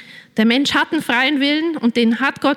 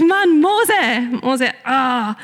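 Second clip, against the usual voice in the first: exasperated; 2 semitones higher, much slower than usual. Retold or imitated. Listeners thinking about stubborn Moses, exasperated. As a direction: throw up your hands at Moses. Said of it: "man denkt sich".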